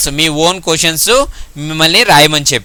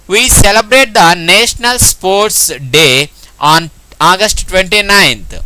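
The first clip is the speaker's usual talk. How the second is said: slow dictation; much slower than usual. Shown as a demonstration. Teacher dictating sentence slowly.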